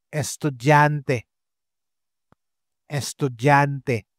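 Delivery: "Estudyante" slowly, with a clear eh; slow model word. Shown as a demonstration. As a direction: spell "Estudyante" out by sound. (mabagal na "Estudyante")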